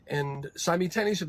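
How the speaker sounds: ordinary talk, nothing marked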